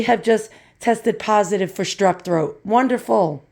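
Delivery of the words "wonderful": ordinary delivery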